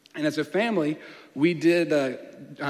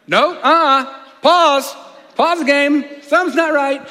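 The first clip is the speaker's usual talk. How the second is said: put-on high, loud childish protest; loud high-pitched kid shouting pause; kid's protest; 10 semitones higher, 10 dB louder, slower than usual. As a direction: demand they stop the game like a cheated kid. Kid protesting at Christmas gift.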